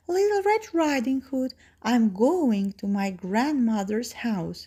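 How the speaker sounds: put-on high, sweet girl voice; little girl voice. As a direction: speak like a small girl. Girl answers wolf.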